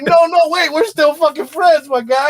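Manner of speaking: excited plea; urgent plea. Retold or imitated. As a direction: stop him and plead. Pleading with the clerk.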